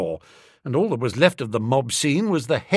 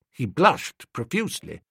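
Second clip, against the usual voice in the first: ordinary delivery, nothing marked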